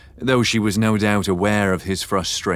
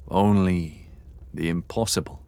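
ordinary delivery

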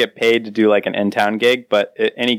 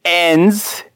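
higher-pitched put-on Australian accent; 5 semitones higher, slower than usual. Shown as a demonstration. Cohost reading a listener's comment on request, Australian accent. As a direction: read it out in a mock Australian accent. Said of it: "All right, Australian accent".

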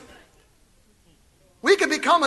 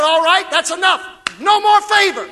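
louder, higher booming decree; voice of God; 6 semitones higher, 6 dB louder than usual. Acted. God cutting off favor from judgmental churchgoers, booming shout. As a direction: pronounce it like God slamming the door. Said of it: "And God said".

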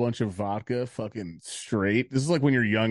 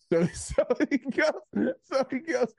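high-pitched, struggling through laughter; real giggles breaking up the words; laughing; 13 semitones higher, slower than usual. Real, not acted. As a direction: try to resume the story while cracking up. Laughing too much to continue.

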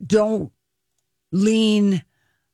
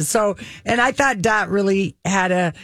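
ordinary talk

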